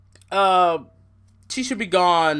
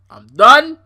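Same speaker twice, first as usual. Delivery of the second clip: much louder, higher, done with it; loud fed-up outburst; 6 semitones higher, 15 dB louder than usual. Real, not acted. Sick of the Bliss feud, a loud fed-up outburst. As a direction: blurt it out, fed up.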